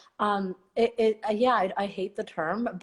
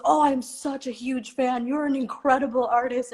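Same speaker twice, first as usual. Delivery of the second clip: gushing fan; 6 semitones higher than usual. Retold or imitated. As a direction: overdo the star-struck admirer. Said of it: "he goes".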